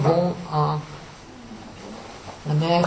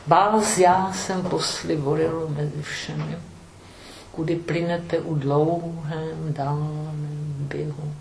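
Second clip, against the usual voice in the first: poem recital; 5 dB quieter than usual. Shown as a demonstration. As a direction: recite it with a grave lyric hush. (lecture de poème lente et solennelle)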